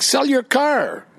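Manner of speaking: slow mock yell across the street; yelled retort. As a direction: shout it back over the road. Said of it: "I yelled back".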